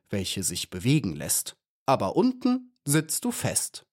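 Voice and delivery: beetle poet reciting in measured verse; poem recital. Acted. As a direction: declaim the little poem line by line with pauses. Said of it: "Dann werde ich Ihnen eine Dichtung vortragen". (feierlicher Gedichtvortrag)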